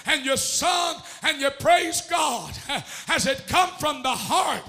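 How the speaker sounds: sermon cadence building in force; preaching. Real, not acted. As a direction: preach with rhythmic, gasped phrase endings.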